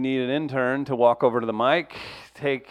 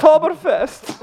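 high, loud put-on protest; offended visitor; 12 semitones higher, 6 dB louder than usual. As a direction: object as an offended foreign visitor. German visitor shouts festival name.

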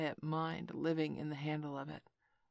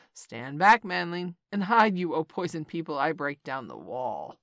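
commanding; 8 dB louder than usual. A narrator's reading. Kaa commands before smashing wall.

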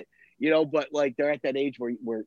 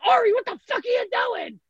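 put-on kid yelling. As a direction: scream it at your brother, high and piercing. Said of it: "Ari's, like, yelling at him".

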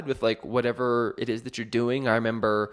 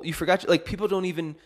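usual speaking voice

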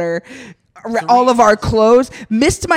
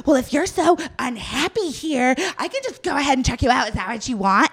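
snippy clerk; 3 semitones higher, 3 dB quieter than usual. Retold or imitated. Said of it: "And he's like".

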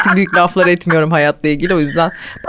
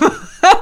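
quick high giggle; high laugh; 13 semitones higher than usual. Real, not acted. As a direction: giggle sharply. Laughing at friend.

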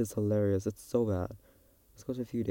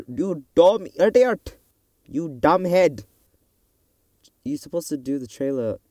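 loud, high, drawn-out scolding of herself; mock self-scolding; 5 semitones higher, 7 dB louder than usual. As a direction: call yourself an idiot, slow and loud. Scolding herself for forgetting trailer.

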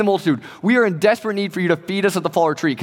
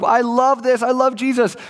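higher-pitched, eager believer; mock enthusiasm; 6 semitones higher than usual. Believers looking back and affirming everything, mock enthusiasm. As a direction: lightly gush like a devoted fan.

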